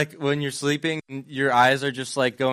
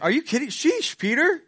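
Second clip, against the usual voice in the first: high, incredulous scolding of a sleepy friend; exasperated Jesus; 8 semitones higher than usual. Retold or imitated. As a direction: roll your eyes at the sleeping disciple, pitch up. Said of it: "Simon, he goes, like".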